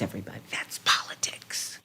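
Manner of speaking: hushed confidential whisper to the audience; whispered aside. Real, not acted. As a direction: drop to a breathy whisper. Drops to a whisper for effect.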